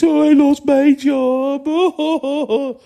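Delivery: put-on high, slow, whiny baby wail; exaggerated mocking sob voice; mock crying baby. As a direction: mimic a grown-up bawling like an infant. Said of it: "something crying like wee babies".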